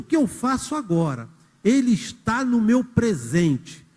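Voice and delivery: poem recitation. Acted. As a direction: read the lines like a solemn poem. Recites the song verse. (declamação lenta e solene)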